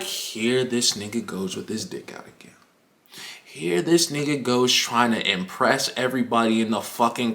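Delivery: low, soft grumble under the breath; weary muttering. Retold or imitated. Grumbling to self as classmate shows off, weary low mutter. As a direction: grumble privately, low and hushed.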